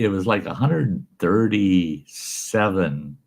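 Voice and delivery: ordinary talk, nothing marked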